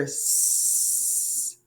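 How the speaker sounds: long, steady hissed "s"; sustained S sound. Shown as a demonstration. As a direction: hold the "s" out on its own. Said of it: "I hear s".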